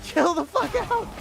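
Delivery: very high, incredulous squeal; mock exasperation. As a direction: shriek at him to calm down, hammed-up. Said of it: "I was like".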